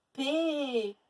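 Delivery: P said as long péé; stretched syllable. Shown as a demonstration. Teacher showing a syllable without A, stretched. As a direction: hold the vowel of P. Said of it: "on l'entend pas".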